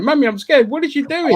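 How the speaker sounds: high-pitched mock frightened kid; scared child. Kid tells mum about the baffling new nanny, scared. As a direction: play a little kid frightened by a stranger. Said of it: "the kids and the mother are scared".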